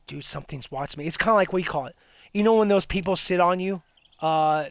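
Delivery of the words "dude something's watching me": quiet inner voice, much softer; inner panic, softly. Retold or imitated. Waking at night, telling himself he's watched, hushed. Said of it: "freaking out in my mind going".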